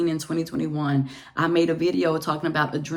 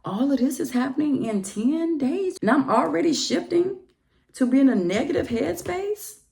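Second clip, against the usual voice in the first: much higher, rising alarm; alarmed self-talk; 8 semitones higher than usual. Retold or imitated. Recalling her own shocked inner voice. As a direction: panic at yourself. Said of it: "And I'm like".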